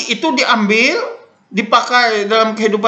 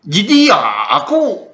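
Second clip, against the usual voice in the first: Barito medok accent. Shown as a demonstration. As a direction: say "Jidia aku" with a thick Barito medok. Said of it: "ada medoknya itu".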